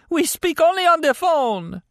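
very high, strained denial; pained, desperate captive. A narrator's reading. Injured captive denying the killing to his interrogator, desperate. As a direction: plead it high, through agony.

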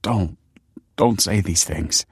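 halting, slowed plea; understated, pained pleading; begging. A narrator's reading. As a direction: beg with restrained anguish. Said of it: "begged Michael".

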